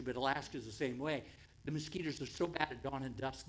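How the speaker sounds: ordinary delivery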